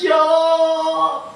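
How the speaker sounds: high, pleading prayer through mock tears; a preacher voicing the Prophet's tearful plea; weeping prayer. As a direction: beg God for forgiveness, half in tears. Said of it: "sambil menangis".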